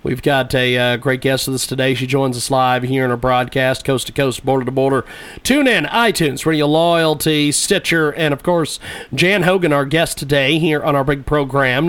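low, steady promo read; radio announcer. Acted. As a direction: read it like a station plug. Host plugs the show.

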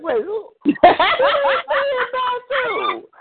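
high, drawn-out indignant outburst with a laugh; mock outrage, stretched out and laughing; retold telling-off. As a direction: act out a stunned, high-pitched challenge. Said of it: "I went up one side of her and down the other".

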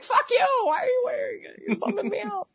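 mock annoyed stranger. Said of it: "They're like".

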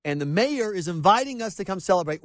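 normal talking voice

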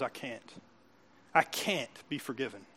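low, hushed self-condemnation; quiet and low, as if to oneself; defeated inner voice. Acted. Preacher voicing someone's internal monologue, defeated and hushed.

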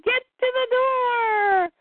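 high, stretched-out mock shout; urgent storybook cry, long vowels; acted urgent call. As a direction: call out in hammed-up panic, without real volume. Acted order while fleeing.

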